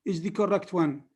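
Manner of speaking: ordinary delivery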